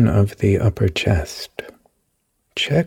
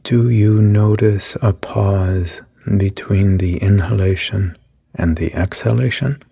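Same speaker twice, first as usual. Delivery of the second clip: calm, even meditation voice with long pauses; guided meditation. Acted. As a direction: lead the listener gently through each breath. Leads a guided body meditation.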